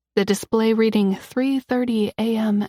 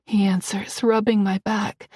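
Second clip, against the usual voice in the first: ordinary delivery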